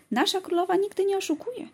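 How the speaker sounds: put-on a small child, certain of her queen; little girl, convinced. A narrator's reading. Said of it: "powiedziała z przekonaniem mała dziewczynka".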